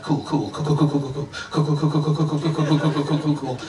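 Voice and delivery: rapid-fire repeated "cool" on one level note; nervous babbling. Retold or imitated. Boyfriend told to call the waiter, nervous babbling. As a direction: stall in a panicky machine-gun run.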